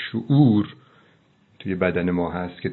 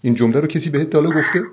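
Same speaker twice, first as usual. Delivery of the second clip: fast, higher and louder; excited question; 6 semitones higher, 4 dB louder, faster than usual. Real, not acted. Host eagerly asks the guest a rhetorical question.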